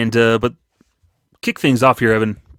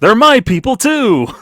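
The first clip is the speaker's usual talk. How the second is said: indignant insistence; 12 semitones higher, 7 dB louder, slower than usual. Pretend. Insisting on Irish heritage.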